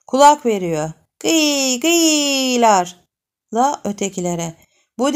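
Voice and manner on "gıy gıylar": playful cries. Acted. Children's play cries imitated.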